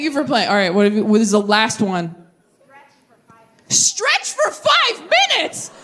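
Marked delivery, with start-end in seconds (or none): 3.7-5.7 high, slow, disbelieving read; incredulous high squeak. Retold or imitated. Comic reading a crowd answer, slow high disbelief.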